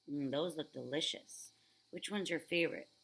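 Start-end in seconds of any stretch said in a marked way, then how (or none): none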